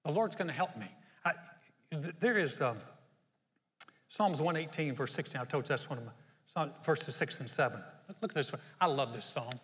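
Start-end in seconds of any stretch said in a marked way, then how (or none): none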